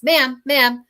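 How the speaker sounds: put-on a girl running after the speaker, calling her back. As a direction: call to stop her as she leaves. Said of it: "she comes running after me and she says".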